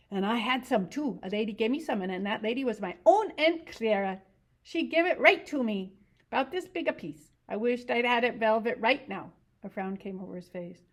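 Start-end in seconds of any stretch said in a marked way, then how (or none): 0.1-9.3 higher simple-minded ranch hand voice, eager; Lenny. Acted. To Curly's wife about rabbits, eager simple voice.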